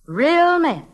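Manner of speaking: teacher driving home her lesson; emphatic. Acted. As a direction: lean hard on real men. Teacher stresses a point.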